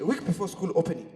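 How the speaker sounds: ordinary talk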